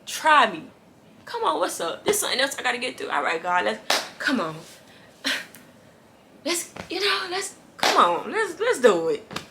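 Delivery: loud, fired-up dare to life; rally yourself, loud and ready for the fight; defiant challenge. Retold or imitated. Her new self daring hardship with God beside her, loud and defiant. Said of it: "But now I'm like".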